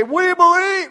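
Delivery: high, loud cry of belief; enthusiastic. Retold or imitated. Congregation answering the preacher's question, enthusiastic high shout. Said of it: "you wouldn't be that enthusiastic".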